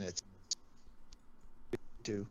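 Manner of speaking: ordinary talk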